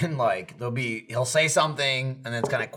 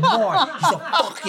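laughing mock insult; 14 semitones higher than usual. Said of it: "he starts mouthing".

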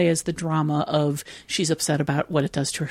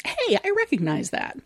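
much higher-pitched voicing of a viewer's thought; pleased audience recognition. Podcaster voicing an audience getting a nostalgia joke, pleased recognition. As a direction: pipe up high, as if spotting something familiar. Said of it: "if the audience thinks".

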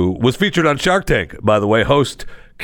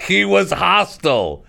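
mocking settler; 10 semitones higher than usual. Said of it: "People were going around and saying".

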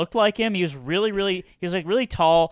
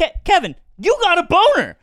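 high, loud hammed-up exclamation; mock-shocked high yell; mock outburst; 9 dB louder than usual. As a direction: blurt it out like a scandalized investor. Acting out Shark Tank investors.

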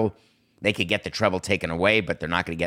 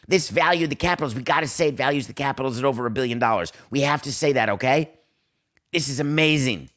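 mocking NHL owner; 5 semitones higher than usual. Host mocking owners cashing in, high giddy voice. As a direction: crow about the money like a giddy owner. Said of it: "Gary Bettman said".

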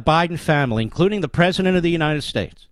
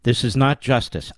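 ordinary talk, nothing marked

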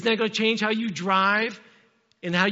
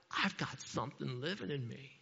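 low, very soft voice of an expectant mother; hushed wonder; 7 semitones lower, 13 dB quieter than usual. Acted. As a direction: drop to a private, awed hush.

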